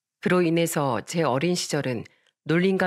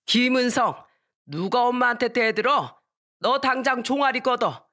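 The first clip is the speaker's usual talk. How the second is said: raised-pitch acted reprimand; stern grandfather scolding; 6 semitones higher than usual. Grandfather takes up the switch, stern angry scolding. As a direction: order a cheeky child to take punishment. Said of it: "할아버진 저한테 회초리를".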